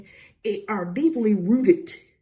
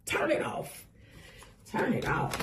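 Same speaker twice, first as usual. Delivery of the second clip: whispered; 8 dB quieter than usual. Acted. Hushed repeat of the command.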